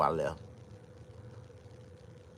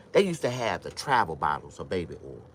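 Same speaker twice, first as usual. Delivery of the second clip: ordinary delivery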